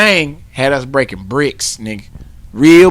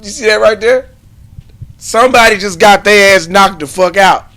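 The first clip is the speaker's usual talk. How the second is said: normal talking voice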